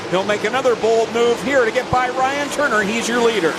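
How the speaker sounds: lively live race play-by-play; motorsport commentator. Real, not acted. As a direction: call the lead battle with rising energy.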